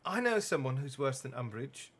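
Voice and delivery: lightly voiced irritable girl; a hint of a sulky teenage sister; grumpy Ginny. Shown as a demonstration. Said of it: "restart in Ginny's voice".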